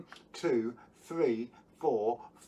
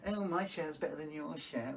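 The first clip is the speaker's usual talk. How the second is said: mock bully kid; 5 dB quieter than usual. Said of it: "they were being horrible to me".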